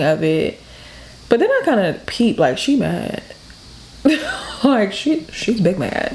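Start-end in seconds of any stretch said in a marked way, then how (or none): 4.0-4.6 short laugh. Real, not acted. Laughing at mom's anger.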